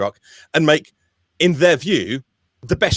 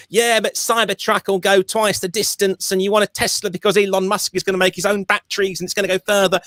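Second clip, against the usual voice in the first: higher-pitched imitation of a nagging friend; mock pestering know-it-all; 6 semitones higher than usual. Friend in your ear pushing Tesla over range, mock pestering. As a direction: drone on insistently, lightly imitating a pesterer. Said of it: "someone's in your ear or your social media".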